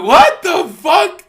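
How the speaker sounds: incredulous shout at the call; stunned disbelief. Retold or imitated. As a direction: stretch the disbelief out. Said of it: "I'm like".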